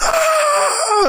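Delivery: very high mock scream; long high cartoon shriek at no extra volume; imitated scream. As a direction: squeal like a trapped cartoon bear. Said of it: "Starts fucking screaming".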